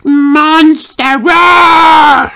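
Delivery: put-on high toddler shout into a long roar; loud call. A narrator's reading. George alerts his family to the monster, shouting loudly. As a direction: yell it, then roar at full voice. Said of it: "called George as loudly as he could".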